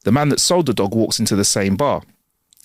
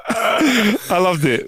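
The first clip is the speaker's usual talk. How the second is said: slow words through leftover laughter; laughing; 8 semitones higher, much slower than usual. Real, not acted. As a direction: let the giggle stretch it out. Laughing after the joke.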